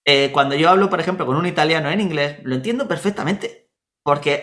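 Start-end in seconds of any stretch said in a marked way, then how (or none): none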